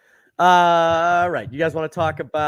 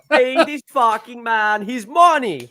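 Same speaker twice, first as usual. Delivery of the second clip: mock demand; 7 semitones higher, 6 dB louder, slower than usual. Acted quote of a demand.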